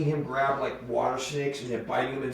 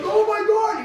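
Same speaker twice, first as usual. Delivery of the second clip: high, loud startled shriek; scared man jumping back; startled passerby; 7 dB louder, slower than usual. Retold or imitated. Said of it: "He's like".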